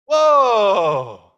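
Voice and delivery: a big, long, astonished whoa; loud amazed whoa. Acted. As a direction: let the wonder burst out loud. Rereading the verse, more amazed.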